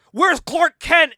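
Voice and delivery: high, loud, drawn-out mock shout; shouted demand. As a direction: burst in demanding, as a hammed-up agent. FBI bursts in demanding Clark.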